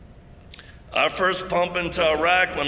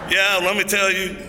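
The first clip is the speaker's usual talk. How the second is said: higher and louder, puffed-up bravado; mock brave; 6 semitones higher, 5 dB louder than usual. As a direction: talk big like you're safe on the phone. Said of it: "I get real brave on the phone".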